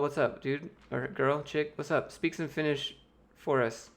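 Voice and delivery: ordinary talk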